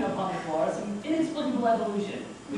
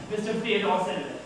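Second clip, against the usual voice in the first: plain reading voice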